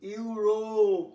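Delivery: ইউরোপ very slowly, with a long held o; long vowel. Shown as a demonstration. Teacher showing the long vowel, ইউরোপ slow with drawn-out o. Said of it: "long vowel হয়ে গেল".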